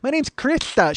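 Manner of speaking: high-pitched mocked speech impediment; Krista. Creepy waitress introducing herself, impeded speech. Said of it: "the speech impediment too".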